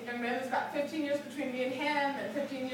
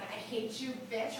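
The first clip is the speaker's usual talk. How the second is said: low, soft mock teenage resentment; imitating a sullen daughter's grudging hello; begrudging teen; 5 semitones lower, 7 dB quieter than usual. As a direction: mutter it grudgingly, low and quiet. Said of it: "but, like, the begrudging".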